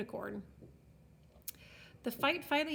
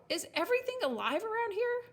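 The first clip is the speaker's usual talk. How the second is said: high, exasperated question; pitched-up, fed-up outburst; snarling; 11 semitones higher than usual. A narrator's reading. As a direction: demand it in indignant disbelief. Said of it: "she snarled".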